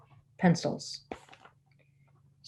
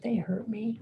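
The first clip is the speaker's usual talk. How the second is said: higher, soft little complaint; grumpy hurt child; 5 semitones higher, 6 dB quieter than usual. Retold or imitated. Hurt boy back from hospital, imitated grumpy complaint. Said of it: "he was pretty grumpy".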